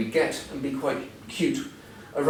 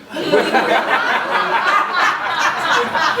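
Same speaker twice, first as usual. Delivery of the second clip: bursts into loud high laughter; hearty open laugh; big laugh; 7 dB louder than usual. Real, not acted. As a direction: let a real laugh break out. Speaker laughs at own joke.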